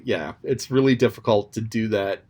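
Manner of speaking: ordinary talk, nothing marked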